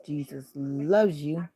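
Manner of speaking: slowly sounded-out "Jesus loves you."; slow reading. Shown as a demonstration. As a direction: read the ball's words slowly. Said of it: "ball that says".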